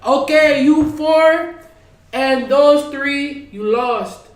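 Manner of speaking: high, loud party MC; host a twerk contest on stage, all showman; hyped MC voice. Retold or imitated. MC eliminating contestants.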